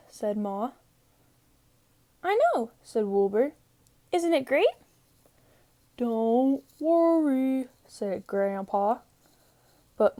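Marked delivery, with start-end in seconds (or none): 2.2-2.7 put-on high, quick, cheerful young sheep voice; Wilbur the lamb. 4.1-4.7 put-on high, quick, cheerful young sheep voice; Wilbur the lamb. 5.9-7.7 put-on slow, calm old grandpa voice; old grandpa sheep.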